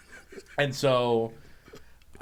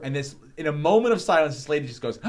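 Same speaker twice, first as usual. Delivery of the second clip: ordinary talk, nothing marked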